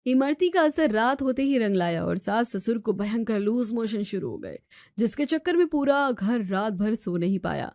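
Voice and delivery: धीमी, गहरी कथावाचक आवाज़; दृश्य बताते हुए शांत नैरेशन; कहानी सुनाना. A narrator's reading. Story narrator between scenes. (कथावाचक की शांत आवाज़)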